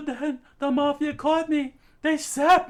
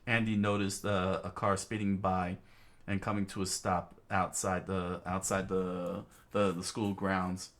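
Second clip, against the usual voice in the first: story narration; 9 dB quieter than usual. A narrator's reading. Narrator describing the tearful classroom, low hushed storytelling.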